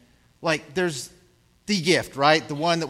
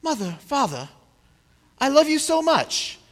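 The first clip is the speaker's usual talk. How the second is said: put-on high, gushing child voice; child gushing thanks to mother and father, high and sweet; imagined grateful child; 6 semitones higher than usual. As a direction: play the adoring child thanking parents. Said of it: "to turn to us and say".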